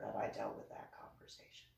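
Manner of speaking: whispered with no voice. Shown as a demonstration. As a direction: breathe it like a secret aside. Repeats it as a whisper.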